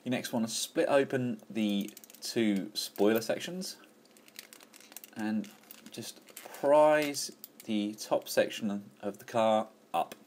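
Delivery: ordinary talk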